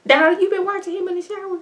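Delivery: high, fast, sassy put-on voice; sassy callout. Teasing Donald about the shower line, high sassy voice. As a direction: needle him with a high teasing voice. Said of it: "I was like".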